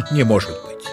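ordinary delivery

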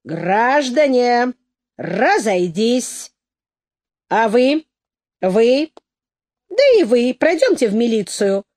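drawn-out crowd-control call; commanding, slow opening, then firm orders; official command. A narrator's reading. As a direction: order people about as a policeman. Policeman orders the crowd to disperse.

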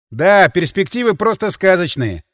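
drawled. A narrator's reading. Sorcerer sizes up the grim prospects, drawling. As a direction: stretch it out with irony. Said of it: "протянул колдун".

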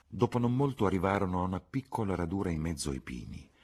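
plain reading voice

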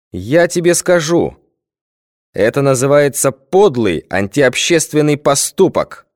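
slow, measured, official solemnity; solemn pronouncement. A narrator's reading. Deputy solemnly branding the boy's deed, slow. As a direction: pronounce the verdict like a speech. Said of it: "торжественно объявил депутат".

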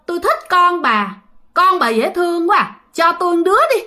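high-pitched spirit voice; acted ghost's creepy request; coveting ghost. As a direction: ask for the child in a high, eerie wheedle. Ghost covets the woman's child.

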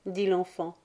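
plain reading voice

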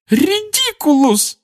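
very high, slow wail of the spell; sobbing cry. Acted. Mrs Weasley failing against the boggart, wailing through tears. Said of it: "крикнула она опять".

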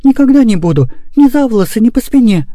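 put-on high, insistent girl's vow; fervent. A narrator's reading. Girl promising brother never again, fervent high vow. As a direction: vow it earnestly as a little girl. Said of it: "горячо воскликнула Мурка".

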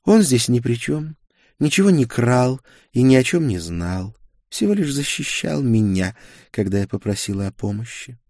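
high-pitched, earnest defence; pleading sorceress. A narrator's reading. Girl defends her companion to the duke, pleading. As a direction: plead his innocence, pitched well up.

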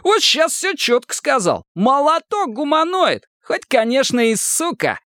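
high-pitched, gleeful praise; jubilant. A narrator's reading. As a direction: crow over him, pitched right up. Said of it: "возликовал Мазур". (ликующе, высоким голосом)

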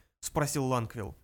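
plain reading voice

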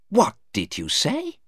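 high-pitched indignant question; very angry. A narrator's reading. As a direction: demand it as an outraged lawyer. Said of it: "asked very angrily".

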